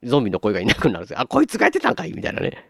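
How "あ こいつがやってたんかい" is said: mock surprised realization. Realizing the parrot did it.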